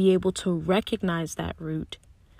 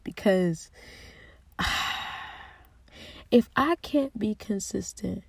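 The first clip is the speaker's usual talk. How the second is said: ordinary talk, nothing marked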